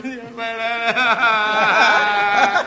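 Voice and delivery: very slow, drawn-out wail; mock sobbing that swells out of the words; hammed-up crying. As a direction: stretch it into a comic bawl. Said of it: "别，别哭".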